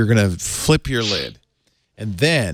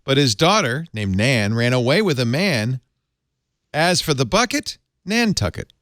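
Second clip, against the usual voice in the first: limerick recital; 4 semitones higher than usual. Shown as a demonstration. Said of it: "this is the original".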